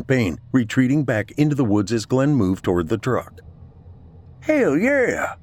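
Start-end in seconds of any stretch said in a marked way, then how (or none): 4.4-5.3 high, drawn-out cheer; exultant yell. A narrator's reading. Captain cheers the hit on the attacker, yelled. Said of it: "Captain Boland yelled".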